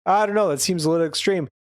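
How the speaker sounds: a hint of unsure Goku; hesitant Goku. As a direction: Goku doubting the heavy weights. Goku voiced in recap.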